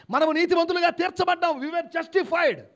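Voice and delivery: high, loud proclamation; forceful preaching. Real, not acted. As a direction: proclaim it to the congregation at full voice.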